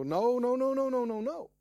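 loud, rising cries of no; screaming no. Retold or imitated. Churchgoers screaming no at the idea. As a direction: scream no over and over in protest. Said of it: "would scream and go".

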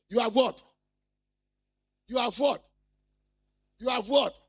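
loud repeated call to the congregation; calling for response. Real, not acted. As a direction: demand the answer from the crowd. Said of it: "preacher calls for response".

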